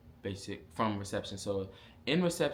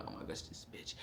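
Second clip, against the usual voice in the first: much softer, whispered aside; whispered complaint; 7 semitones lower, 16 dB quieter than usual. Retold or imitated. Trainee about the drill sergeant, exasperated whisper. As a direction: whisper it under your breath in disbelief.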